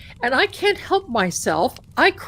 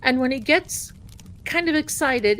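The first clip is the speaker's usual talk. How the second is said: ordinary talk, nothing marked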